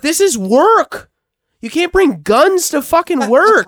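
high, emphatic comic outrage; mock scolding. Friend telling muscular coworker off at work, mock outrage. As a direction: scold your buddy as if scandalized. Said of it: "I was like".

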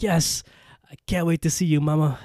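high, loud, hammed-up glee; gleeful. Girl running off with ectoplasm to regrow Mama, mock gleeful. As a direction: imitate an overjoyed girl, pitched well up. Girl thrilled mother will come back.

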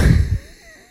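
sudden high laugh bursting out; loud high-pitched laugh; burst of laughter. Real, not acted. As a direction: crack up at the nose joke. Laughing at his own joke.

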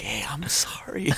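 whispered, drawn-out mock apology; slow whisper, imitating a movie line; Nicolas Cage. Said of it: "and he's like".

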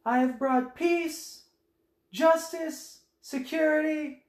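mock-grand declaration, slow and high; grandiose Anakin. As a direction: announce it like a new emperor. Anakin's movie line quoted.

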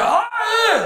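very high comic cry; sudden high-pitched "ah", hammed up; mock cry. Comic telling a crude story, mock cry.

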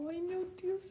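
breathy high sigh; swooning aside. Real, not acted. Reader swooning between lines of the love scene. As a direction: melt at the line.

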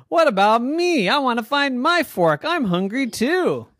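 high, loud complaining voice; whiny dad. Acted. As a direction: grown man whining like a kid. Daddy complains hungry.

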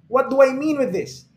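ordinary delivery

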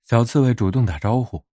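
ordinary delivery, nothing marked

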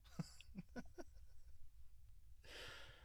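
high, breathless quiet laugh; soft airy laugh. Real, not acted. Soft airy laugh after saying F my life. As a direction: let a quiet wheezy laugh out.

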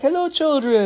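very high, theatrical greeting; genie-like grandpa. Retold or imitated. Grandpa rolls in to greet children, high genie voice. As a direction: imitate a genie saying hello way up high. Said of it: "like, a fairy or, like, like the genie".

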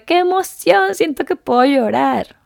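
high, loud burst of joy; retold giddy excitement; excited. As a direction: squeal it like a thrilled fan. Retelling joy at book signing.

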